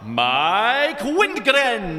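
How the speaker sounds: booming wrestling ring announcer, high and loud; lucha libre announcer. Pretend. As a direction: hype him up like a ring announcer. Mock announcer intro of [name].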